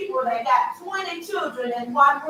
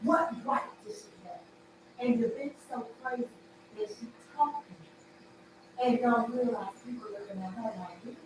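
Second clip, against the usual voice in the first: whispered scorn, much softer; hushed rant; 9 dB quieter, faster than usual. Real, not acted. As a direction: drop to a secret scornful hush. Ranting about her, dropping voice.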